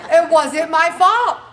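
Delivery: loud, slow, laughing protest; laughing mock plea. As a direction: cry out your innocence through laughter. Mock excuse, laughing while pleading.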